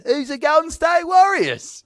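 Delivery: very high, slow English imitation; English accent. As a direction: mimic a clueless English stranger. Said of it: "in an English accent".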